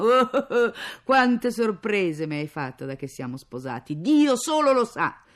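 loud mock-wailing, drawn-out derision; bitter sarcasm. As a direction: deride a husband's promised surprises. Mother derides husband's surprises. (sarcasmo amaro a gran voce)

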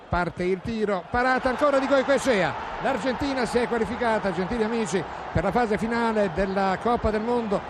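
high, loud call of the decisive save; excited save call. Real, not acted. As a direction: rise on the last save, then announce. Final save, elimination.

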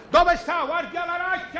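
high hollered call; shouting. Acted. Contestant calling for the guard over the teacher, shouting. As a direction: yell for someone who is missing. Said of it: "Non gridi, non strilli".

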